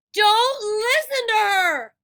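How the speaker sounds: high, loud, slow shout. A narrator's reading. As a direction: shout from across the statues. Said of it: "Annabeth's voice shouted".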